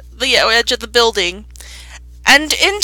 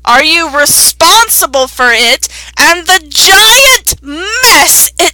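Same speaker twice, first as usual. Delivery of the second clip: loud, slow, high accusation; angry guard; 6 semitones higher, 6 dB louder, slower than usual. Acted. The guard demands the druid answer for the mess.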